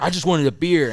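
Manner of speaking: upset. Retold or imitated. Upset customer complaining.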